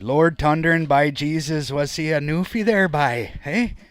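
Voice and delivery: loud, high hammed-up Newfie accent; Newfoundland accent. Telling the chat his dad was a Newfie, Newfoundland accent. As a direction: belt it out like a Newfie at the kitchen party. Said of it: "My dad was a newfie".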